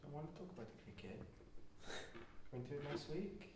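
very soft Welsh lilt; Welsh accent. Acted. Grumbling to co-hosts about the cricket, soft Welsh voice. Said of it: "That's my Welsh voice".

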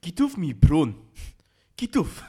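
loud, high put-on South African accent; mock South African shout. Mocking the film for co-hosts, South African accent. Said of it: "'cause of the accent".

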